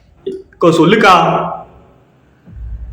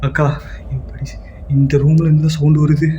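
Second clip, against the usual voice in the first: ordinary talk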